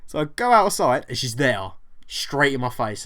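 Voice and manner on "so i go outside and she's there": usual speaking voice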